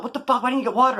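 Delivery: imitating annoyed barracks mates, pitched high; high-pitched mock outrage, not shouted; indignant demand. Barracks mates demand the missing water, indignant demand.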